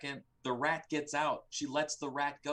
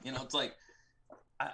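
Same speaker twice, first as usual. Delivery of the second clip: ordinary talk, nothing marked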